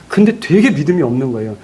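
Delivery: ordinary talk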